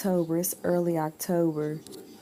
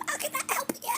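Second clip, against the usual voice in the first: whispery, squeaky croak, far higher and softer; fake lost voice; 6 dB quieter, much faster than usual. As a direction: pretend your voice is nearly gone. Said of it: "went back to my regular voice".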